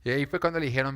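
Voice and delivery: usual speaking voice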